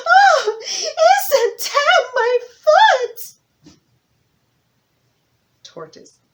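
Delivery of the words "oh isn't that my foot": very high, loud, slow wail; wife crying out. Acted. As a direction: cry out in pain to her husband. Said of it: "she cried out for her husband".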